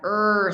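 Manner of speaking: drawn-out American "er"; rhotic R. Shown as a demonstration. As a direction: hold "er" with a strong R. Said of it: "the rhotic R".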